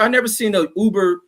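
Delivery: usual speaking voice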